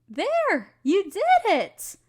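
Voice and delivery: bright, high cheer of delight; excited praise. Acted. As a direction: celebrate the student's success out loud. Student finishes the potion.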